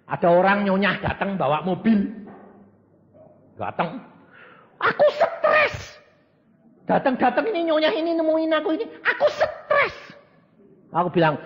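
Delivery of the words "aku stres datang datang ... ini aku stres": high, wailing woman's voice; whiny mock lament, pitched way up; mimicking distressed rich lady. Preacher mimics the upset rich lady, high and wailing.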